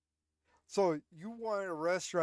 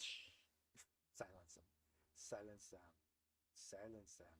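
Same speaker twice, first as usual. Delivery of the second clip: hushed, much softer "shh, silence them"; mock librarian hush; 7 semitones lower, 18 dB quieter than usual. Joking about arming librarians, whispered hush. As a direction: play a librarian shushing the room.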